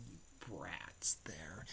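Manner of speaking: slow, whispered taunt; menacing whisper. Retold or imitated. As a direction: breathe the taunt out slowly, no voice. Villain taunts the heroes.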